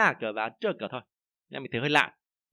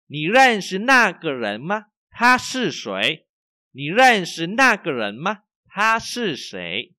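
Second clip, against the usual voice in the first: slow, loud, clear Chinese to repeat after; slow model reading; 7 semitones higher, 8 dB louder, much slower than usual. Shown as a demonstration. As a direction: read it out slowly for students to echo. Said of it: "Lại nha".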